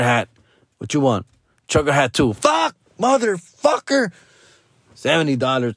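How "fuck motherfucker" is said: high exasperated curse; dad hears another hat costs more, high exasperated outburst; cursing. Retold or imitated. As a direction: blow up at the price tag. Cursing at prices.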